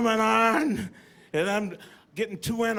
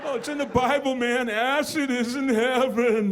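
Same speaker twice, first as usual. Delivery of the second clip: lightly voiced hippie roommate, higher; stoned hippie; 4 semitones higher than usual. Roommate pitching acid, lightly voiced hippie. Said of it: "do I gotta talk like that too".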